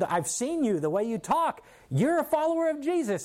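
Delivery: high, insistent accuser; retold accusation. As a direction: press the charge, pitch climbing sharply. Woman accuses Peter once more.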